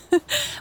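short, high giggle. A narrator's reading. Elle laughs at Louise's quip, giggling. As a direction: let out a little high-pitched giggle. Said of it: "Elle giggled".

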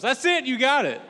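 very high, loud mock-excited cheer; student's triumph. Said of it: "He goes".

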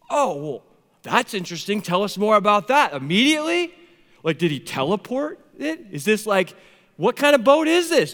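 loud, high, baffled questioning; exasperated mock interrogation rising to an outburst; mock incredulity. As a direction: press for answers in comic disbelief. Mock disbelief at instant arrival.

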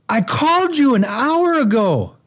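high, slow, drawn-out complaint; indignant mock yell. Lady who thinks they answer emergency calls, hollering indignantly. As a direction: imitate her outraged high-pitched hollering. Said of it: "and she goes".

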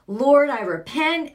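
loud declaring prayer; bold loud prayer. Retold or imitated. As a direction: proclaim it with force.

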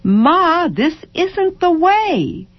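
put-on child whining to mother; child's complaint. A narrator's reading. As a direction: drag it out like a tired child. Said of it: "child complaining".

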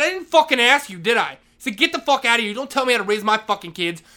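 angry yell. Retold or imitated. Vice principal dismissing the janitor, angry yell. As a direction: shut him down yelling. Retold shouting match with principal.

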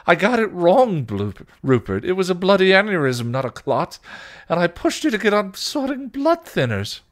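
high, loud, frantic self-blame; anguished Spike. Acted. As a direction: pour out the guilt at a high pitch.

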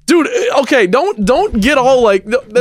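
can't believe what he just heard; incredulous outburst. Real, not acted. Friend disses a favourite show, he protests in disbelief. Reacting to Fresh Prince diss.

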